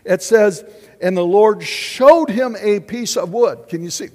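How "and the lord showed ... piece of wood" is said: louder, weighty reading of showed; emphatic reading. Shown as a demonstration. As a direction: stress "showed" in the verse. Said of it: "don't just read words".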